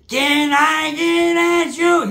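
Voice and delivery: high, drawn-out mock wail; exaggerated imitation of the cousin in bed; cousin overheard in the act. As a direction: stretch the catchphrase high and slow.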